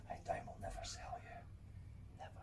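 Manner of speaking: hushed whisper, like a promise to a beloved piece of gear; devoted secret whisper; tender whisper. Pretend. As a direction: breathe it softly to the interface. Promising his interface he'll keep it.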